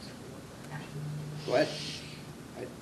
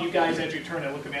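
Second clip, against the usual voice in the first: normal talking voice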